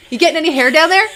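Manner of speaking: imitating her mother checking on her; nosy mom. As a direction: play the prying mother. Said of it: "my mom just used to be like".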